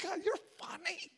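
high voice with laughter breaking through; amused high protest to God; laughing at God. Retold or imitated. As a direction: laugh it out in disbelief. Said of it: "I'm like".

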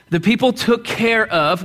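ordinary talk

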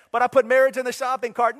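high, loud whining protest; mock loud protest. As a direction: plead like a cheated shopper. Mock complaint to God.

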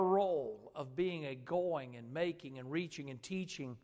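earnest, projected prayer in measured phrases; public prayer. Real, not acted. Preacher prays to God for the congregation, earnest public prayer. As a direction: lead the congregation in heartfelt prayer.

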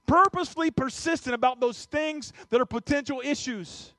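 loud, driving sermon delivery with hammered repeats; full-force preaching. Acted. Pastor urging parents and mentors not to look away, loud preaching. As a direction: press the point home to the flock, pounding each phrase.

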